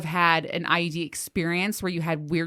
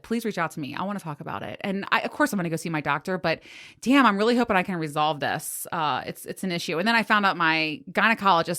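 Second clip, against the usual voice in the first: ordinary talk, nothing marked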